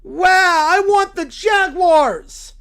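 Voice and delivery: high, loud, slow want; loud defiance. Acted. Friend insisting on the Jaguars, loud and slow. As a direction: drag it out stubbornly.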